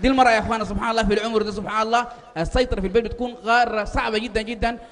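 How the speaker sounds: ordinary talk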